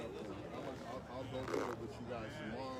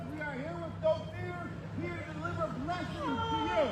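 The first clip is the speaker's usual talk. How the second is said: a light, slightly raised host announcement; announcer style; 11 dB louder than usual. Acted. Host introducing himself and his partner to the crowd, in announcer style.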